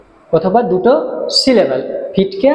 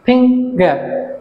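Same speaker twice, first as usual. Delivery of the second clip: Finger in two beats, ফিং then গার; slow, higher finger with a short gap; syllable split; 4 semitones higher, much slower than usual. Shown as a demonstration. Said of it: "দুটো syllable".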